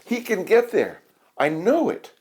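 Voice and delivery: high, eager voice, pitched well up; Sammy cheering Ned toward the shoes; excited hope. A narrator's reading. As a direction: urge him on with growing belief. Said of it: "shouted Sammy".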